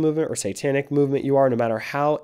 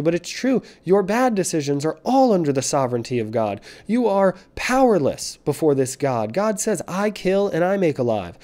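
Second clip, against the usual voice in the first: preaching at full force; 5 semitones higher than usual. Acted. Preacher warning scoffers of God's wrath, at full force. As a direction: hammer the warning home like a street preacher.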